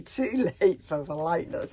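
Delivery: ordinary delivery, nothing marked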